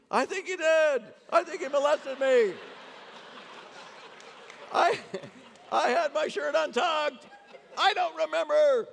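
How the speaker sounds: loud, high-pitched agitated wail; Jerry impression. Jerry answers Elaine after the dentist, loud high-pitched mock distress. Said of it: "he comes back from the dental appointment".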